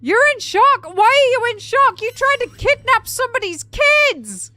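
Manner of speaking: high, very loud disbelieving rant; incredulous outraged scolding. Acted. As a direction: shout it as if telling off a would-be kidnapper. Mock-scolds Lohan for claiming shock.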